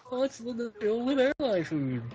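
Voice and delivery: high, slow stand-up comic impersonation; Jerry Seinfeld. Pretend. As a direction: stretch it out up high, comedian parody. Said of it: "that was a good impersonation".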